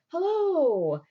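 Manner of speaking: high, drawn-out hail; sing-out mock-casual hello; calling from afar. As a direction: pretend to call out as you arrive. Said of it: "just now arriving from a distance".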